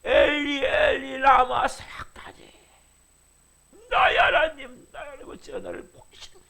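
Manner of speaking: high, tearful, breaking into a whisper; anguished wail. Retold or imitated. Preacher voicing Jesus' dying wail to his Father, tearful. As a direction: sob it out in despair. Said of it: "절규하고 있다면".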